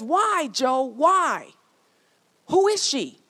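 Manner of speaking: high, loud, anguished pleading; mother's anguished cry. Retold or imitated. A mother confronting her husband over infidelity, anguished high cry. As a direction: cry out in heartbreak at the betrayal. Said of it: "she says".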